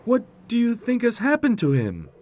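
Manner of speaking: very high, loud cartoon question; puzzled tractor Tech. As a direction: ask in a high-pitched tractor voice. Tech worriedly questions Roy.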